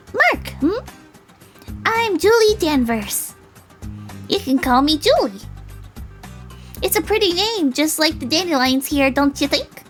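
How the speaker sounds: put-on high, lilting, friendly girl; sing-song, high-pitched sweetness; cheerful dandelion girl. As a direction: introduce yourself like a perky girl. Voices Julie, the dandelion girl.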